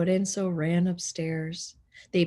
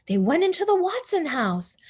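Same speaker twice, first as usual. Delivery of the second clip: usual speaking voice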